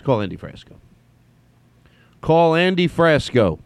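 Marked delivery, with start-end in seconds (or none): 2.2-3.6 high, slow, deliberate command. Real, not acted. Host telling the phone to call Andy, deliberate voice command. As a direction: enunciate it for a machine to catch.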